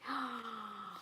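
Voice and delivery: soft breathy gasp of pretend wonder; hushed little gasp at the reveal; whispered mock gasp. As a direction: gasp under your breath. Reveals the secret compartment.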